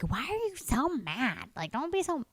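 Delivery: slow, high, soft whiny friend; mock Beata voice. Friend mocking her for being upset, slow high whine. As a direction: mimic the friend nagging, slowed and whiny. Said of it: "you're gonna be so mad that I'm doing your voice like that".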